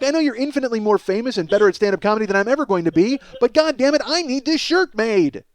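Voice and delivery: ordinary delivery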